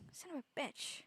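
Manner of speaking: normal talking voice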